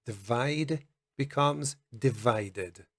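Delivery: divided said slowly with id; slow demo. Shown as a demonstration. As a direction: stretch out divided. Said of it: "We have end becomes ended".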